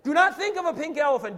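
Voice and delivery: loud, high forceful order; shouted command. Shown as a demonstration. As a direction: shout the warning at the whole room. Shouts the command after roaring.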